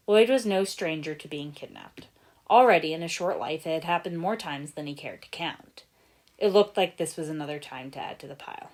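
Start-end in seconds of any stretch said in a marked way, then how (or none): none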